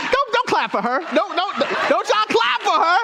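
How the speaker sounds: high-pitched playful protest; mock protest. Preacher telling congregation not to applaud his wife, mock-indignant. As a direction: scold the crowd in mock indignation.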